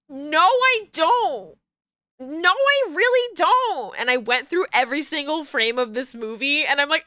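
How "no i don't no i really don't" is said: hoher, langsamer Protest; high, slow denial. Retold or imitated.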